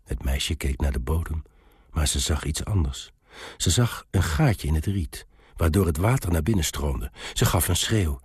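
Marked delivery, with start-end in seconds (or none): none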